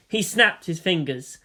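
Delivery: usual speaking voice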